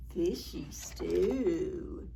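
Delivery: slow hungry whisper. Pretend. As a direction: breathe it out like a scheming cat. Cat's thought whispered.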